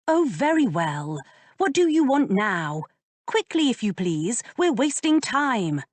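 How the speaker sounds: lightly voiced lady, grudging and impatient; impatient young lady. A narrator's reading. Georgiana gives in impatiently.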